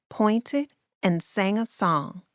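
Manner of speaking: usual speaking voice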